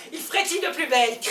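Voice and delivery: usual speaking voice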